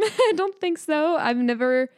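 ordinary talk, nothing marked

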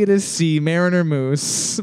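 ordinary delivery

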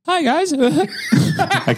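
very high squeaky cartoon mouse; high falsetto Mickey greeting. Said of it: "Can you do, uh, Mickey?".

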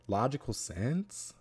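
normal talking voice

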